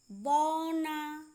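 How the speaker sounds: बौना slowly, with the au drawn out; long au. Shown as a demonstration. Teacher modelling au for learners, stretched au in बौना. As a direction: hold the first vowel of बौना. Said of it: "अधिक समय लगता है".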